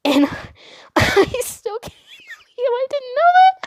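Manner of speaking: talking through high, squeaky giggles; helpless laughter. Real, not acted. Storyteller recalling she didn't know the word, helpless laughter. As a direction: lose it laughing and squeeze the words out. Breaks into laughter at her mistake.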